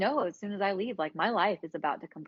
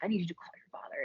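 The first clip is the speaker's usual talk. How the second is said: quick urgent whisper; 5 dB quieter, faster than usual. Retold or imitated. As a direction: hiss it fast under the breath so no one hears. Ex on phone, secretive.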